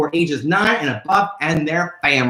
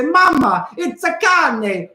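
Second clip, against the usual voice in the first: put-on child talking; 7 semitones higher, slower than usual. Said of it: "you can point to it and say".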